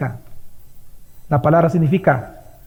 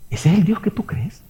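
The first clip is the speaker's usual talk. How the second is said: hushed pointed question; a sudden whispered challenge to the congregation; 4 dB quieter than usual. Real, not acted. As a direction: whisper it right at them. Preacher drops to whisper.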